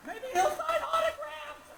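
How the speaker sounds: high sarcastic tirade climbing to a shout; sarcastic rant. Retold or imitated. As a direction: build from griping to yelling. Ranting about getting recognized.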